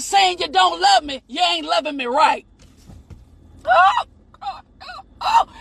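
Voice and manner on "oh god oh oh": high, cracking cry to God; overcome, cry out to heaven; ecstatic cry. Real, not acted. Preacher overcome, crying out.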